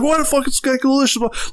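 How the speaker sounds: strained high pretend shout; mock yelling. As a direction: imitate gunmen barging in with a question. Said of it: "three or four guys yell at him".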